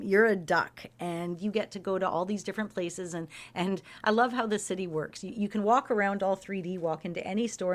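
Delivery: ordinary delivery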